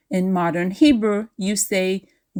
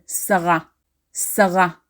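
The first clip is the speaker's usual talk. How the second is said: Sarah said "sa-RA"; stress on last syllable. Shown as a demonstration. Teacher showing the formal stress, Sarah as "sa-RA". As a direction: put the weight on the end of Sarah, formal Hebrew "sa-RA". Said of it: "we formally stress the last syllable".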